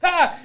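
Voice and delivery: very high, loud pretend scream; mock shriek at a customer; fake scared shriek. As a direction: yelp as if startled. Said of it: "pretend I get scared".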